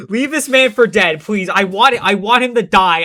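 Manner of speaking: normal talking voice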